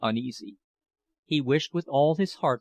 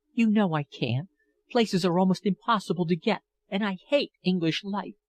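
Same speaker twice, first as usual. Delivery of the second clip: higher, tearful pleading woman's voice; pleading older lover; 6 semitones higher than usual. A narrator's reading. Older woman begs departing young lover, higher and pleading. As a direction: beg him not to leave, voice lifted and aching. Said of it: "she refuses London".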